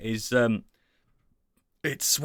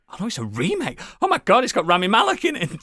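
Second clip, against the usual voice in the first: excited discovery; 8 semitones higher, 3 dB louder, faster than usual. Retold or imitated. Telling friends how the remake popped up, excited. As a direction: pitch jumping up, a little louder, thrilled.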